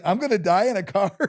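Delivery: high, giggling mock dread; laughing joke. Uncle laughing about dying in Danny's car. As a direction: laugh through the fake fear.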